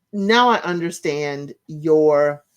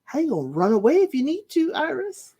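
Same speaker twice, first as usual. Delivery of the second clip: fast, then much higher on "Iris"; playful mock worry, rising sharply at the name; teasing question; 8 semitones higher than usual. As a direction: tease her about the big skirt. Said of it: "Then I'm like".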